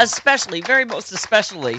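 "very" punched, "especially" pitched high; mock emphasis. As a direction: pile on "very most especially" sarcastically.

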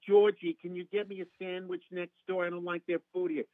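high, put-on mother voice; Estelle Costanza. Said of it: "And she says".

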